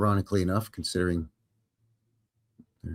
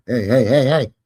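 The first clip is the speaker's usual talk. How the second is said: lightly voiced scolding censor, rising loud at the end; mock YouTube warning; 3 semitones higher, 7 dB louder than usual. YouTube scolding the vlogger over naughty words, mock stern warning. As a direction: pretend to be YouTube telling him to calm down. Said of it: "YouTube is probably saying".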